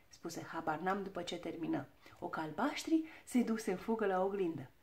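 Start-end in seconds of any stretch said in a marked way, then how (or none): none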